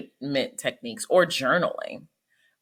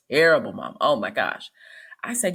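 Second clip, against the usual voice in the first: ordinary delivery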